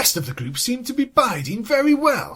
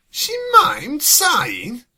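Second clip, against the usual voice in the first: incredulous; 2 semitones higher, 4 dB louder, slower than usual. Real, not acted. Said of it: "What the hell is wrong with her?".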